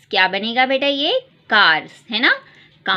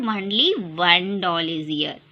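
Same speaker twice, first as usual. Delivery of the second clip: slow, lower, deliberate answer; slow model answer; 4 semitones lower, much slower than usual. Shown as a demonstration. Teacher modelling the answer slowly for children. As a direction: say it slowly so kids repeat. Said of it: "ये कितने doll है यहाँ पर".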